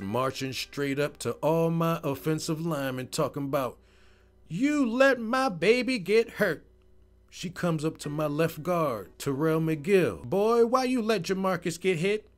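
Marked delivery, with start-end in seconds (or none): 4.5-6.7 high, loud accusation; wailing reproach in grandma's voice; upset grandma. Retold or imitated. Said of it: "She was so upset". 10.2-12.3 high-pitched scolding; raised, reproachful grandma voice; upset grandma. Retold or imitated. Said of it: "She was so upset".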